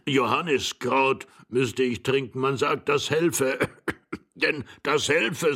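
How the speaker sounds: put-on doddering old voice with throat-clearing; forgetful old man. Old husband complaining of weak memory to his wife, doddering. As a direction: ramble on, clearing the throat between phrases.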